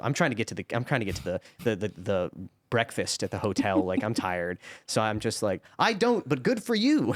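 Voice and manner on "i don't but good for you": high, drawn-out polite dismissal; sing-song "not interested" politeness; breezy brush-off. Retold or imitated. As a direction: cheerfully wave off a chatty stranger. Brushing off stranger while walking on.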